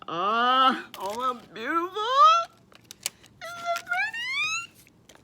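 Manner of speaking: high, slow, drawn-out gush of admiration; delighted gush. Acted. Teacher showing children her fish, gushing high and slow. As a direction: swoon over the craft like it's a treasure.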